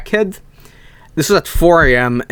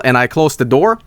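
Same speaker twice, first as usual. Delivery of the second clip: ordinary delivery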